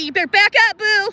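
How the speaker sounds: loud, taunting warning at the geese; half-joking shout at a goose; yelling at geese. Real, not acted. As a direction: tell the goose to back off, playfully loud. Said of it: "I'm yelling at the geese".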